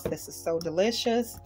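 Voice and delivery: ordinary delivery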